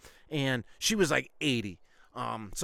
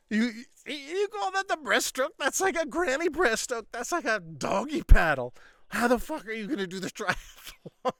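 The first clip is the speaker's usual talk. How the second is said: much higher, louder, laughing disbelief; mocking laughter; 10 semitones higher, 6 dB louder than usual. Coach mocking student's breaststroke, retold laughing disbelief. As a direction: ham up the coach cracking up at a terrible swimmer. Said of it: "this dude was fucking doubled over laughing".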